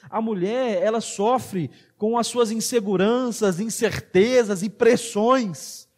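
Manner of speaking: ordinary talk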